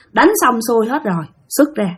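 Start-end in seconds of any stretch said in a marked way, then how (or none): none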